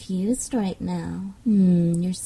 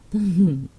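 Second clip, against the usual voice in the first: teasing laugh; 6 dB louder than usual. Acted.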